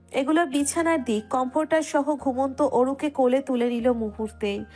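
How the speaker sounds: ordinary delivery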